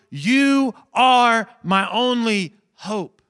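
slow, high, loud plea to God; a slow plea of surrender to God; pleading surrender. Retold or imitated. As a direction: lean on each word, begging.

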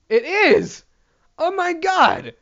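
high, loud burst of disbelief; shocked realisation. Real, not acted. Comic realising the joke is Kevin Hart's, shocked. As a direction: blurt it as it dawns on you.

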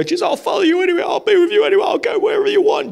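high, fast, shouted plea; excited shout. Retold or imitated. Healed man begging Jesus to follow him, fast excited shout.

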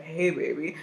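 put-on low, soft British-accent come-on; Idris Elba. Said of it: "in his British accent".

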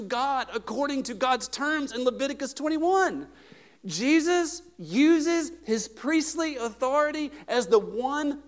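high, loud pulpit proclamation; full-force preaching. Real, not acted. Preacher declaring Jesus' priestly authority, full force. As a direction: drive each word out slowly and loudly.

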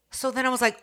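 ordinary talk, nothing marked

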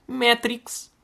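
a high squeaky "Matrix." in place of a curse; prim substitute word. Shown as a demonstration. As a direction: pipe "Matrix." high as a cute swear. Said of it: "dizer, tipo".

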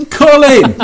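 loud sudden call; shouting a name. Real, not acted. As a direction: yell his name across the room. Calling out to a friend.

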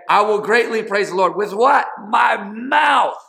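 loud, high preaching shout. Real, not acted. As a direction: lift it up and shout the praise. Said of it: "I'm gonna get louder".

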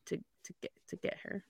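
whispered "To get her" word by word, spelling it out; whispered to self. Real, not acted. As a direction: whisper each word to yourself as you spell. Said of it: "I'm like".

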